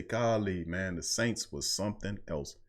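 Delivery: a hushed future fan looking back in wonder; hushed awe. Retold or imitated. Host imagines fans' future reaction, hushed awe. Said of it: "you gonna say".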